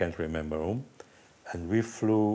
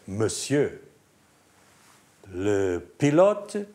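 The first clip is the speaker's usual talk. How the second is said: French policeman; 4 semitones higher, 3 dB louder than usual. Retold or imitated. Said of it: "He said".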